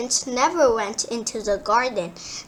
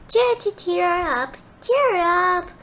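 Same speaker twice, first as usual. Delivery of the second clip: birdsong voice; 5 semitones higher than usual. Acted. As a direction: sing it like a bird. Nightingale sings.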